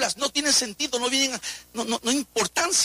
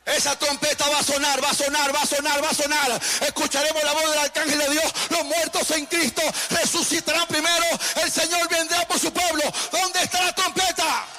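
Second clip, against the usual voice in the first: preaching at full force; 7 semitones higher than usual. Acted. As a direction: thunder the warning to the church. (predicación fervorosa a todo pulmón)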